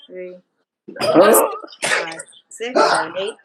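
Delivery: loud, punchy count of each number; stern rhythmic counting. Real, not acted. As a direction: bark out the count for the class. Instructor counting out stretch reps.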